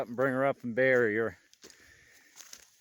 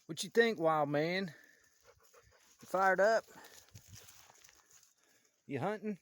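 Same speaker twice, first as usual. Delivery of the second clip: high, playful voice for the dog; talking to the dog; 5 semitones higher than usual. Acted. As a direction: cheer up the dog.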